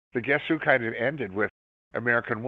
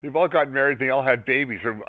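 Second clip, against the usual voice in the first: ordinary delivery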